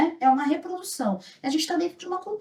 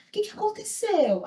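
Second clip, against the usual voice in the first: higher-pitched, concerned question; imitated concerned woman; 8 semitones higher than usual. Canteen owner coming to help a child, imitated concern. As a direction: quote a rescuer's startled question. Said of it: "ela falou assim pra mim".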